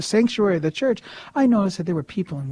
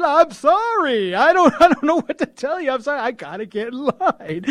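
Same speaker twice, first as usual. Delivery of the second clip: frantic apology; 8 semitones higher than usual. Retold or imitated. Husband excusing himself to leave his wife praying, high frantic apology. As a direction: plead in a high voice, breaking into laughter.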